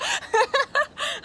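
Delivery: a loud, high squealing laugh; high burst of laughter. Real, not acted. [name] laughing at the price shock, high squeal. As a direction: let the laugh break out high.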